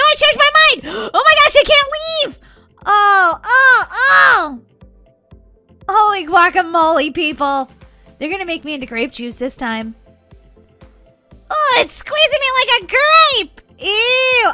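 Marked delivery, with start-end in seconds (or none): 0.0-2.4 high, loud panicked yelling; mock panic. Real, not acted. Panics, cannot leave the game. 2.9-4.6 high, loud drawn-out "oh" cries; dismayed wail. Real, not acted. Being squeezed in the game. 11.5-14.5 high, loud squeal of mock horror; grossed-out wail. Real, not acted. Squeezed like a grape.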